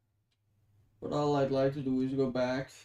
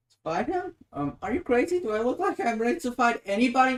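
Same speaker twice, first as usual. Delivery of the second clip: higher and louder, in disbelief; incredulous; 7 semitones higher, 7 dB louder than usual. Acted. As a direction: balk at the idea, outraged. Shocked at being asked to fight.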